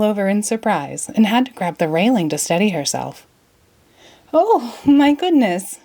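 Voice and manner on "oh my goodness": slow, high, louder gasp of wonder; exclaimed. Acted. Alice startled by talking wallpaper flowers, slow high exclaim. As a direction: draw it out, amazed. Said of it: "she exclaimed".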